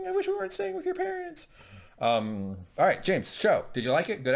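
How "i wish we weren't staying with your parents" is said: much higher, laughing fake sob; mock sobbing. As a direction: whimper it like a pitiful boyfriend. Boyfriend's mock tearful plea.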